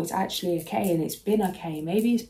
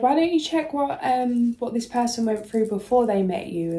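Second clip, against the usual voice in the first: inner voice; 5 semitones higher, 4 dB louder than usual. Retold or imitated. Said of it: "I just heard".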